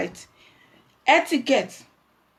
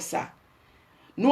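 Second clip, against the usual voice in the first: ordinary delivery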